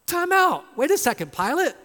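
high, loud objection; incredulous protest. Acted. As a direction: break in sharply, stopping him in disbelief. Preacher interrupts Pilate in protest.

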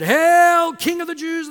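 high, booming shout of false homage; mocking hail. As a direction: hail him loudly in mockery. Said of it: "in mockery".

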